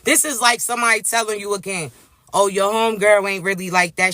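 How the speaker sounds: normal talking voice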